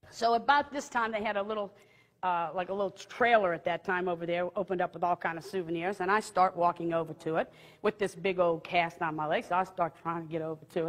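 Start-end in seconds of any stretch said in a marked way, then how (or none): none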